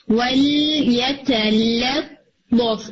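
ولیتلطف very slow with both lams light. Shown as a demonstration. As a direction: stretch ولیتلطف keeping the lams thin. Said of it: "لطف نہیں".

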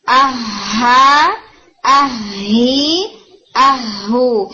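very slow stretched آہا آہی آہو, doubled ہ then each vowel; حرکات کی مشق آہستہ; vowel drill. Shown as a demonstration. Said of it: "یہ ساؤنڈز آپ یاد رکھیں".

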